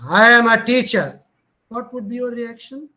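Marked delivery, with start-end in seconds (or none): 0.1-1.2 loud, arrogant, AM pushed hard. Shown as a demonstration. Teacher showing students an arrogant tone, loud and proud. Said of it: "He's very rude, arrogant".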